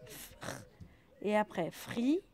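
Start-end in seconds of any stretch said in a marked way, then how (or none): 0.1-0.6 fr soufflé, sans voix; whispered fr sound. Shown as a demonstration. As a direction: faire chanter fr tout bas. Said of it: "Donc j'ai trouvé le fr". (son fr chuchoté)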